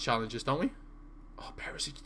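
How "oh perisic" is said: whisper. Real, not acted. Reacting to game play.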